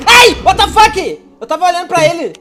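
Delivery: high, loud shocked cry; yelp, then protest; startled yelp. Real, not acted. Atacado de surpresa. (grito de surpresa)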